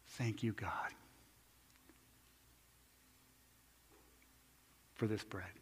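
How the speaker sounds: soft, breathy whispered thanks; whispered prayer. Acted. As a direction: whisper the grace over the bread. Paul's prayer breaking bread.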